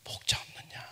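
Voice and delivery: faint whisper. Acted. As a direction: let it fade into a whisper. Repeats phrase as whisper. (아주 작게 속삭이듯)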